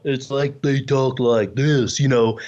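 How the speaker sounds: low, slow dopey voice; dumb character. Shown as a demonstration. Ventriloquist showing how dumb puppets talk, low dopey voice. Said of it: "if somebody is dumb".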